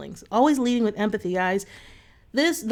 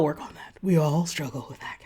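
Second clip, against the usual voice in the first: whisper; 6 semitones lower, 5 dB quieter than usual. Real, not acted. Confiding aside to viewers.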